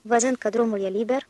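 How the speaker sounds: plain reading voice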